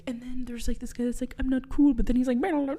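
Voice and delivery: hammed-up rambling summary. Host imitating Dewey's crazy summary to Ned.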